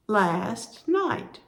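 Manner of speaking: normal talking voice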